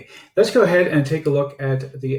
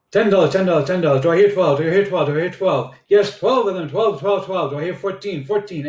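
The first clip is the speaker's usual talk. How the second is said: auctioneer voice; 4 semitones higher than usual. Pretend. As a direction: call out the bids like an auctioneer. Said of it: "like an auctioneer".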